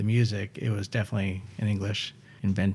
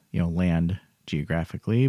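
ordinary delivery

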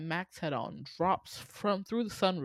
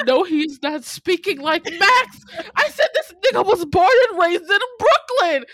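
much higher, louder incredulous yelling through laughter; outraged laughing rant; 12 semitones higher, 12 dB louder than usual. Retold or imitated. Host reliving her outrage at the accent change, laughing. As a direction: yell it in disbelief, laughing. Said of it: "I was like".

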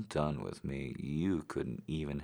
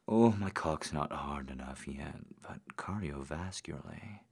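whispering. Acted. Whispers mixed in.

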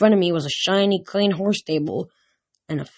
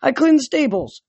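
raised, high-pitched protest; yelling; 8 semitones higher, 3 dB louder than usual. A narrator's reading. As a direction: call it out insistently. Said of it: "I yelled".

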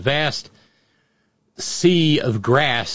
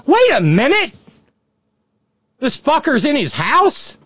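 loud high outburst; wait, what, stunned; shocked; 12 semitones higher, 4 dB louder than usual. Acted. Mock outraged reaction to clip.

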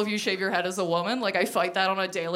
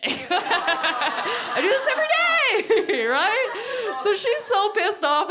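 laughing through the words, high; 10 semitones higher, 3 dB louder, much slower than usual. Real, not acted. Comic admitting her daily routine, laughing high. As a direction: crack up at your own habit.

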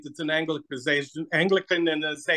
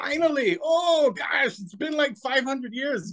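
mock excitement; 8 semitones higher, slower than usual. Mock-gushing greeting of host.

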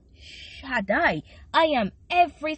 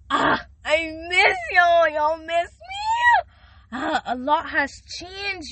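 hammed-up wail, high and loud; mock crying; 7 semitones higher, 5 dB louder, slower than usual. Host mock-wailing to her listeners that she missed them. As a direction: pretend to weep over missing them.

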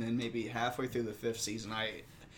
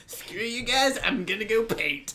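high, loud bratty cartoon kid; whiny cartoon brat storming off; Cartman impression; 9 semitones higher, 4 dB louder than usual. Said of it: "sound like fucking Cartman".

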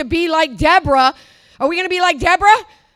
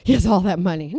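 mocking awed churchgoer; 7 semitones lower than usual. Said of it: "The church".

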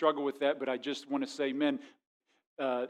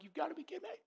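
much higher, much softer, breathless incredulity; whispered disbelief; 12 semitones higher, 15 dB quieter, much faster than usual. Retold or imitated. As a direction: mutter it under your breath, stunned. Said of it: "I'm just like".

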